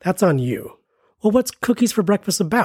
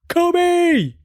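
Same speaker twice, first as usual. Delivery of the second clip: high, louder excited yell; Kobe shout; 12 semitones higher, 3 dB louder than usual. Pretend. Remembering basketball, excited fan shout. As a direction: call it like a courtside fan.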